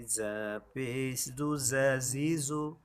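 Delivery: normal talking voice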